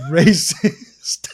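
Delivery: slow, laughing through the words; can barely get it out for laughing. Real, not acted. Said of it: "I can't stop laughing right now".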